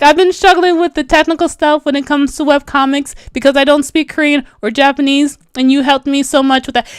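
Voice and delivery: usual speaking voice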